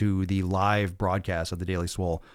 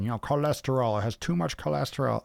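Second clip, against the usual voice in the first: mocking know-it-all; 5 semitones higher than usual. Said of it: "Everyone's gonna chase you down with this study".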